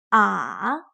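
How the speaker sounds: "Ah" dipping low, then rising; drawn-out "Ah" with a down-then-up pitch; third tone. Shown as a demonstration. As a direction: let "Ah" sink and climb back up. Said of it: "dips down".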